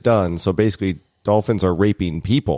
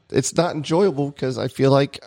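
normal talking voice

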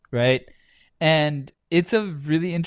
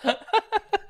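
high wheezy giggle; can't stop wheezing; wheezing laugh. Real, not acted. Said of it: "[name] broke himself".

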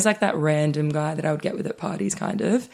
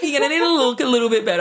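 mocking replay; 7 semitones higher, much faster than usual. Mockingly replays the man's insult.

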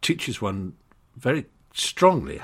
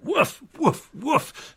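high dog barks; much slower than usual. Retold or imitated. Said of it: "it went".

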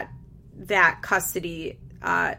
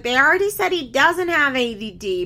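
quoting Barb; 6 semitones higher, 6 dB louder than usual. Retold or imitated. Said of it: "Barb's like".

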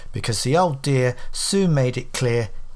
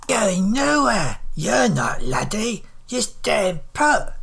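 put-on slow, stern old woman, pitch raised; vice-like grip; 6 semitones higher, slower than usual. A narrator's reading. Old woman stops the man from leaving, unyielding. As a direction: hold him there with a slow order. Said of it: "Her voice was like a vice".

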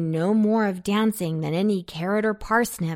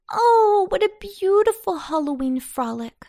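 sighing happily; 11 semitones higher, 5 dB louder than usual. A narrator's reading. Little girl after a night of dancing, sighing happily. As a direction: sigh it out high with delight. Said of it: "sighed Babette".

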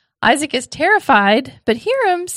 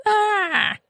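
mock scream; 9 semitones higher than usual. Hiram runs away in hysterics.

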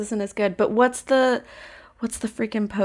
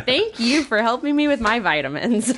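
laughing; 3 semitones higher than usual. Real, not acted. Player breaks character laughing.

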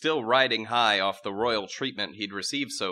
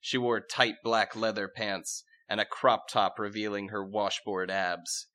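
ordinary delivery

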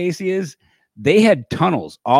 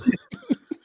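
high laugh; 5 dB quieter than usual. Real, not acted. Laughing after Klink impression.

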